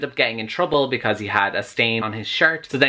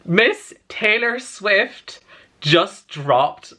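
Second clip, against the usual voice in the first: excited; 6 semitones higher, much slower than usual. Real, not acted. Announcing big [name] news.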